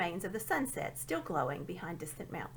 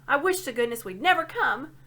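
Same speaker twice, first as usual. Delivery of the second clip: upset; 5 semitones higher, 8 dB louder than usual. A narrator's reading. Scrub complaining.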